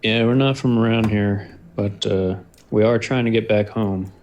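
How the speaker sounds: usual speaking voice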